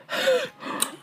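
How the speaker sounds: giddy shocked squeal of laughter; high squealing laugh. Real, not acted.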